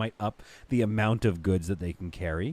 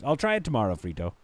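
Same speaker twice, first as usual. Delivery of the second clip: ordinary delivery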